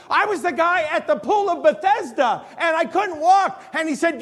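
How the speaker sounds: high, loud, thrilled testimony; excited testimony. Retold or imitated. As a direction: share it like a healed man bursting with joy. Healed man excited.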